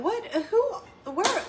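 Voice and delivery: high, laughing mock confusion. Mimicking the kid's confusion while laughing. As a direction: laugh through a confused question.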